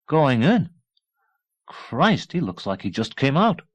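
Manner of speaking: amazed scoff; incredulous. A narrator's reading. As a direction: technician marvelling. Technician amazed at Rambo.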